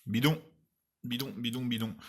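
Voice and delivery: scoffing, biscuit rising high on each bidon; scornful. Real, not acted. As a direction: dismiss the claims with a jeering bidon. Mocking the health claims.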